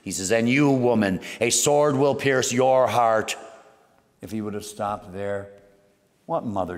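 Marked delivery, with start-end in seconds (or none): 0.3-3.4 booming prophecy. Retold or imitated. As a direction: declare it like a prophet over the crowd. Simeon prophesies to Mary.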